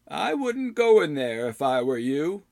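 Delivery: put-on high, slow old man voice; slow warning from a creaky old hermit. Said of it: "The voice was that of an old man".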